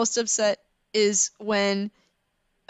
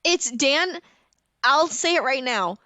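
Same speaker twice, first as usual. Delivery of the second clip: worked-up rant; 6 semitones higher, 3 dB louder than usual. Real, not acted. Ranting about toxic romance novels.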